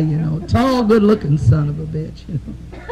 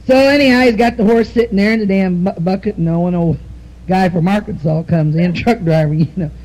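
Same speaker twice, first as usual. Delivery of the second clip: ordinary talk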